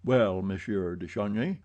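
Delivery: ordinary delivery, nothing marked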